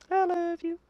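very high, soft singsong; cutesy falsetto. Pretend. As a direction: coo it in a tiny squeaky voice. Repeats it in silly falsetto.

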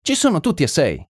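high-pitched exclamation; excited exclamation. A narrator's reading. Narrator tells colonel all six are running, high excited exclamation. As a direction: exclaim it high, falling at the end.

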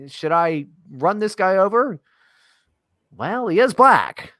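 lightly voiced driver pondering aloud, pitched high; mock deliberation, high-pitched and drawn out toward the end; imagined driver. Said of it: "nobody driving a car is like".

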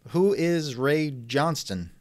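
ordinary talk

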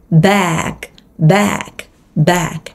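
"back" with a wide æ vowel. Shown as a demonstration. Teacher drills the æ in back for learners. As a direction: smile then drop the jaw for "back". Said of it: "âm A E".

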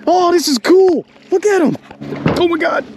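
high, excited squeals at the otters; thrilled. Real, not acted. Angler surrounded by otters in the bayou, excited high squeal. Otters swimming all around him.

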